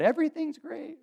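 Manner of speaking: high, loud mock cheeriness; hammed-up insistence that all is fine; fake cheer. Sinner puts on a front for church friends, fake cheer. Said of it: "we put on a front".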